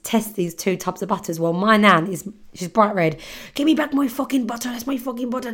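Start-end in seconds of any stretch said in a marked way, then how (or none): 3.5-5.5 high, fast mock tantrum from an angry nan; imitating a nan raging at airport security; kicking off. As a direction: play the furious nan, pitched up and rushed. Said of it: "she is kicking off".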